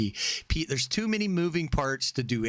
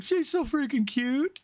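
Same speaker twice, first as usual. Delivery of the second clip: high, slow, squealy cute voice; cutesy girl voice; 12 semitones higher, slower than usual. Retold or imitated. Imitating a Japanese girl talking to a YouTuber. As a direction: gush it like a smitten fan. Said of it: "it was just like".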